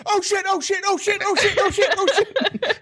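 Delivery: high, frantic squeaky bug voice, laughing; panicking moth voice. Retold or imitated. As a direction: play a tiny bug in a panic.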